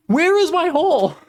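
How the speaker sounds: high, loud outburst of frustration; high-pitched frustrated yell; exasperated cry. Real, not acted. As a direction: wail it out, you've lost it. Frustrated, calling for lost hole.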